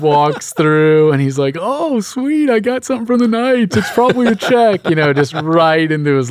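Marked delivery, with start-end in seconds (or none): 1.6-4.9 high, giddy mock excitement; excited squeal. Friend imitating Jacob finding his letter, high mock excitement. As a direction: imitate a thrilled seminarian finding a check.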